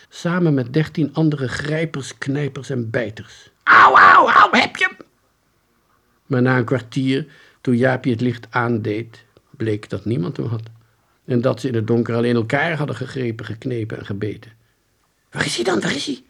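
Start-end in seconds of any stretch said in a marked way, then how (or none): 3.6-5.1 high, very loud yelps; pained shouting. A narrator's reading. Children fighting in the dark. 15.3-16.2 very high, urgent questions; frantic searching. A narrator's reading. Confused children search for thief.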